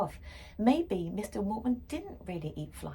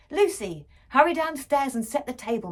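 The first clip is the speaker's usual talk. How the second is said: loud, higher call up the stairs; dad calling; 6 semitones higher, 11 dB louder than usual. A narrator's reading. Dad calling his daughter from upstairs, loud. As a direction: shout it out to the whole house. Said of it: "my dad called from upstairs".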